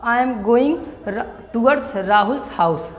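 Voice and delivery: each word spaced out slowly for students to note; slow classroom dictation of an English sentence. Shown as a demonstration. Teacher dictating example sentence.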